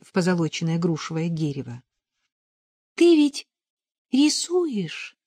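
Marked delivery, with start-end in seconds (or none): 3.0-5.2 high, drawn-out tentative question; hesitant, higher girlish query; shy, embarrassed. A narrator's reading. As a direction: ask it bashfully, pitch lifted. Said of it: "Параша, смутясь".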